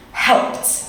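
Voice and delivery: "Helped" with an audible final t. Shown as a demonstration. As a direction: let the t release at the end of "Helped". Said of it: "can you hear that sound".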